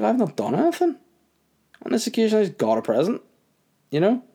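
very high-pitched mock innocence; defensive protest. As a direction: squeak out a retold protest of innocence. Retold defensive protest to boss.

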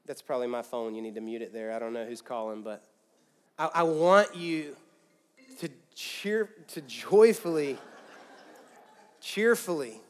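usual speaking voice

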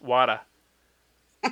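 WADA, Boston water with r dropped; Boston accent. Shown as a demonstration. Showing how people in Boston say it, Boston accent. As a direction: repeat a Bostonian "water" without the r. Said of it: "how do people in Boston say it".

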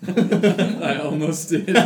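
cracking up as you admit it; laughing. Real, not acted. Answering the British accent joke, laughing hard.

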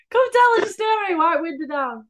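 high, loud pretend shout from a car; hammed-up street call; mock calling out. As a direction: holler playfully to strangers as you drive by.

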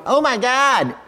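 high-pitched, throwaway mock exclamation; mimicking a shallow, trivial outcry; glib exclamation. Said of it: "He's not just glibly going".

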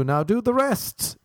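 high, drawn-out comic command; mock demanding. Comedian ordering the vision board he created, mock demanding. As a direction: climb high and stretch the order.

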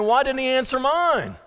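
high-pitched acted complaint; aggrieved protest. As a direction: protest as the one left unanswered. Voicing the believer left unanswered.

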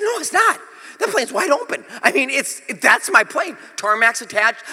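high-pitched, insistent arguing; acted-out argument, pitch jumping up at first; indignant protest. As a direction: plead the obvious in exasperated disbelief. Acted argument with gate agent.

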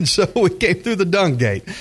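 real chuckles between the phrases; laughing. Real, not acted. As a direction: talk on while laughing at your own joke. Laughing at his own joke.

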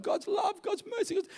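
normal talking voice

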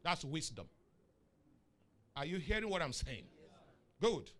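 usual speaking voice

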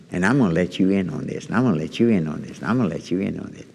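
fast and fading repetition; building repetition. Acted. Repeated, speeding up and fading.

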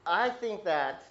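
normal talking voice

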